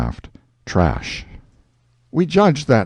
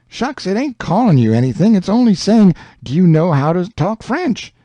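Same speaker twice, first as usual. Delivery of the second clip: put-on higher boyish Huck voice, eager; Huck, higher, setting Jim straight; young Huck explaining; 8 semitones higher than usual. Huck tells Jim about French, eager boyish voice.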